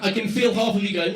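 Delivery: ordinary talk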